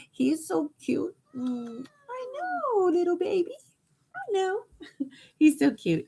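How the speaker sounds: high cooing pet voice. Real, not acted. Owner cooing to her new stray cat, high baby talk.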